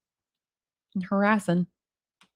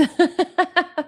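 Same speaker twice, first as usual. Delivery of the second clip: high laugh; 8 semitones higher than usual. Real, not acted. Laughs at comment.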